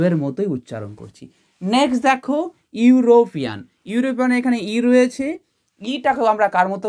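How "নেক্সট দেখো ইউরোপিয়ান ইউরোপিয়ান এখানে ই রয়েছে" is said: ordinary delivery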